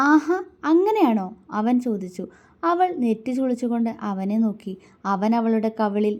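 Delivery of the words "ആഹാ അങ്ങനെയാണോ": high, loud, mocking question; mocking scorn. A narrator's reading. Man answering the woman's claim to her rights, mocking. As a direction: jeer with a raised, sneering lilt. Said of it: "അവൻ പുച്ഛത്തോടെ ചുണ്ടുകൂട്ടി".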